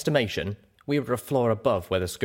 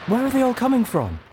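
loud, high alarmed cry; 5 dB louder than usual. A narrator's reading. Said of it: "cried out Velvet Remedy".

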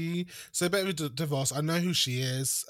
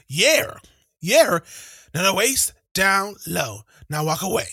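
hype man; 4 semitones higher, 8 dB louder than usual. Retold or imitated. Imitating [name] hype.

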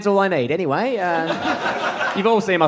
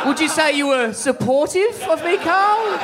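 higher, teasing cross-examination; teasing a friend on stage with a higher mock-accusing voice; mock accusation; 6 semitones higher than usual. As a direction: grill Carl like a mock lawyer. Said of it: "mock accusing Carl".

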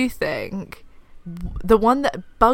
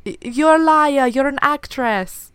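higher, slow, hammed-up Italian accent; bad Italian accent; 7 semitones higher, slower than usual. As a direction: play the Italian islander with a cheesy Italian accent. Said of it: "Really bad accent".

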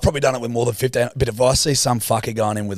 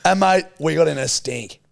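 ordinary delivery

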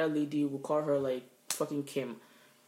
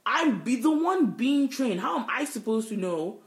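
high, loud exasperated protest; exasperated, drawn-out rhetorical outburst; indignant retort; 8 semitones higher, 5 dB louder, slower than usual. Retold or imitated. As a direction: protest in disbelief, pitch jumping up. Indignant acted retort to supervisor.

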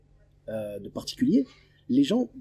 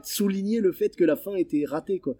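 normal talking voice

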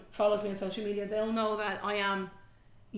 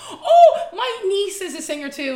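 much higher and louder, gushing; mock gushing stranger; 9 semitones higher, 13 dB louder than usual. Imitating a stranger bragging about her singer niece, gushing. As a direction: gush like a proud auntie bragging.

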